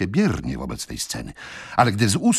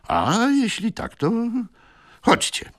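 higher, slow opening, then unvoiced come-along; lightly voiced green-coat. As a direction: usher him off briskly. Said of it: "rzekł zielony frak". (lekko zagrany zielony frak, ponaglający)

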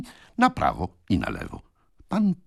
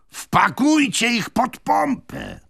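high, slow, loud street-seller voice; a rowdy hawker egging the crowd on; pie seller's advice; 14 semitones higher, 3 dB louder, slower than usual. Acted. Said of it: "doradzał jakiś przekupień". (wysoki, głośny głos przekupnia)

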